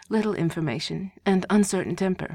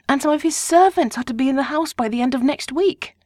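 higher, louder excited chatter; gossiping wife; 6 semitones higher, 5 dB louder than usual. A narrator's reading. Wife relaying neighbourhood gossip to husband, excited higher voice. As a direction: rattle off the gossip eagerly.

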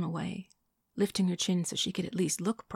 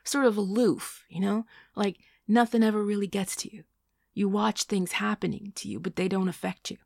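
louder, emphatic, gaining momentum; picking up steam; 3 semitones higher, 7 dB louder than usual. A narrator's reading. Man listing her faults, picking up steam. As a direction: press the point with growing force. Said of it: "picking up steam now".

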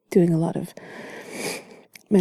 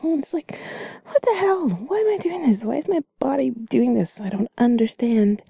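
high yawn-stretched wail, settling gradually; baffled, high-pitched yawning talk; yawning complaint; 3 semitones higher than usual. Acted. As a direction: protest through a huge yawn. Acts out a body seized by yawns.

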